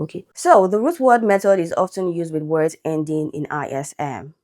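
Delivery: ordinary talk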